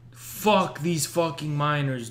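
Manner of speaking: ordinary talk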